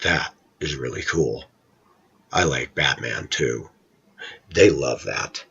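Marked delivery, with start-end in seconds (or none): 0.0-3.7 hushed, lower, genuine approval; sincere, down-to-earth. Shown as a demonstration. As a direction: talk quietly, on the kid's level. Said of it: "I'll lower myself right down to their eye level".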